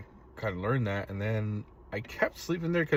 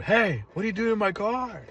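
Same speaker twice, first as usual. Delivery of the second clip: high, loud challenge to an intruder; retold yell; 12 semitones higher, 5 dB louder than usual. Confronting the man trying to steal his car, yelling. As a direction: re-enact yelling at someone in your car. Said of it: "I started yelling at him".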